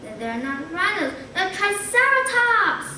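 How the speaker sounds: rising to a high, loud cry of wonder; excited realisation. Acted. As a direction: burst out with the discovery. Boy realises he sees dinosaurs.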